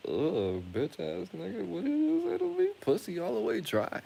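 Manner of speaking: high-pitched mock disgust; disgusted sneer. Woman sneering at a broke man, mock disgust. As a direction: imitate her revolted face in a high squeal. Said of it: "she made this face like".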